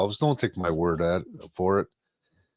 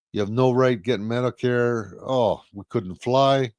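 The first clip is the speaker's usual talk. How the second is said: ordinary talk, nothing marked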